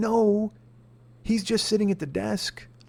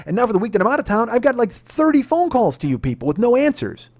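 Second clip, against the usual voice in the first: high, loud furious outburst; angry customer yelling at the insurance agent; retold angry yelling; 7 semitones higher, 5 dB louder than usual. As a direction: let him have it, voice climbing and loud. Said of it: "I'm louder".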